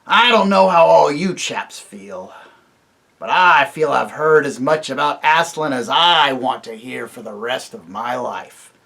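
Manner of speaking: a fed-up dwarf sneering at the talk of Aslan; surly dwarf. Acted. Griffle scoffs at the visitors, loud and surly.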